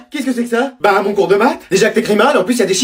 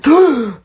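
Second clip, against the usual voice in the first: shocked gasp; 4 semitones higher than usual. Acted. Shocked by hologram mother.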